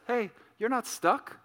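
softly, lightly, with no alarm at all; cool as a cucumber. Retold or imitated. Wife calmly telling screaming daughter she is fine, cool and unbothered. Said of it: "cool as a cucumber".

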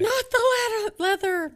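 high, pleading panic; panicked plea. Retold or imitated. Recalling a close call in the car, high panicked plea. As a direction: beg the car seat to be spared. Imitating panic while driving.

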